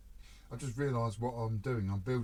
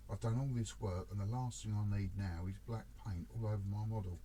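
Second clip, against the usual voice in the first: ordinary delivery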